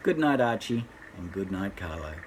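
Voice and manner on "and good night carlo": soft goodnight. Real, not acted. Grandpa says a soft goodnight.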